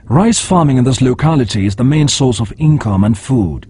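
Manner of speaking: on-location reporter, steady news delivery; news field reporter. Acted. On-location climate change report.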